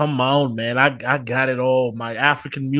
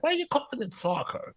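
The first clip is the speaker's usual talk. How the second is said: high, soft disbelief; incredulous; 11 semitones higher, 9 dB quieter than usual. Retold or imitated. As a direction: act baffled by the word. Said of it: "I'm like".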